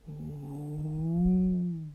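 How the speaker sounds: long eerie howl; wolf howl. Acted. Wolf howls again. (волчий вой)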